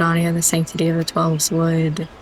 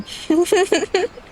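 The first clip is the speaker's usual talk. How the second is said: real laugh, very high and light; laughing at the name; high giggle fit; 13 semitones higher than usual. Real, not acted. Laughs at Private Thicket.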